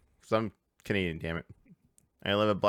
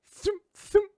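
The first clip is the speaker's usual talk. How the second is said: high, clipped thump thump; mimicked gunshot thumps; 3 dB louder than usual. Retelling the ambush at the tram, mimicked gunshot thumps. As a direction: punch out two shots as noises.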